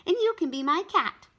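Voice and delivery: put-on bossy little girl; lightly voiced little girl. A narrator's reading. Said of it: "Ramona informed him".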